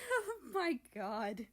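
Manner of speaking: laughing through the words; breathless with giggles; giggling. Acted. Japan laughing at America's panic. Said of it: "between giggles".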